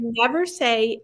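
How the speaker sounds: ordinary talk, nothing marked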